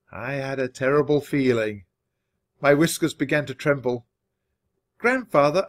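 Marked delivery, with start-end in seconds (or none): 5.0-5.7 a high, louder squeak of alarm. A narrator's reading. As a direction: pipe up in alarm. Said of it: "I squeaked".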